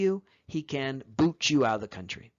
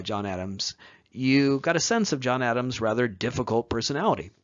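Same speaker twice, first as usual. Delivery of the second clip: ordinary delivery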